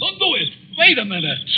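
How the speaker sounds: high, desperate call to stop her; a man calls out to a woman about to leap, urgent shout. Acted. Stopping a jumper on bridge.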